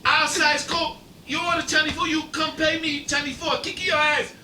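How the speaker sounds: high fake Chinese accent, pushy; bad Chinese accent. Prank caller threatening Mister Watts, bad Chinese accent. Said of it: "in the worst Chinese accent you ever heard".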